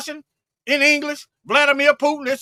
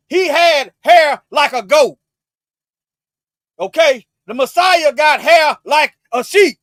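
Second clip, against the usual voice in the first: slow loud emphasis; 2 semitones higher, 7 dB louder, slower than usual. Real, not acted. Preacher pressing a point on Esau.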